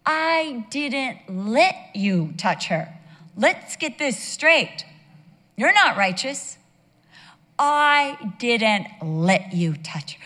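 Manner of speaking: slow, loud, emphatic correction; acted divine rebuke. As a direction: set him straight slowly and forcefully, as God. God rebukes the protesting king.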